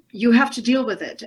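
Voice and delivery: ordinary delivery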